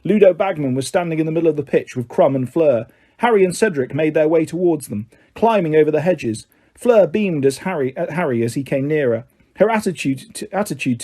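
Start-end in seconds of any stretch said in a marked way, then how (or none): none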